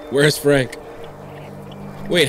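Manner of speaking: ordinary talk, nothing marked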